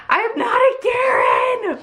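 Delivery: high, drawn-out wailing shout; mock wailing protest. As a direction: wail it out in exaggerated protest. Mock protest after venting.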